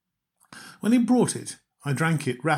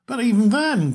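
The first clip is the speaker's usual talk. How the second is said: louder, higher protest; cried out; 9 semitones higher, 7 dB louder than usual. Acted. Lady cries out her objection to the narrator, loud. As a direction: cry out the objection. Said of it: "cried the American lady".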